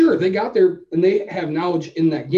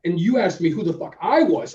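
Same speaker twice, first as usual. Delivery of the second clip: normal talking voice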